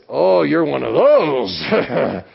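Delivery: slow, loud, drawn-out mocking put-down; slow sarcastic jab at a churchgoer; mocking friend. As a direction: sneer it out long and loud like a scornful friend. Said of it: "his friend went like this".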